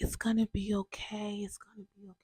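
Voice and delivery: soft whispered reassurance; whispered comfort. Retold or imitated. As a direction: whisper it like soothing a crying child. Mom comforting her crying daughter.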